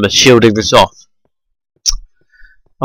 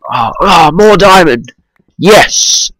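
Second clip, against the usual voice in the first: higher, delighted cry; excited; 6 semitones higher, slower than usual. Real, not acted. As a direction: squeal with joy at the find. Finds more diamond.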